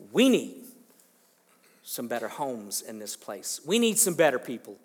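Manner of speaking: loud, emphatic townspeople calling out; shouted crowd demand; mock loud demand.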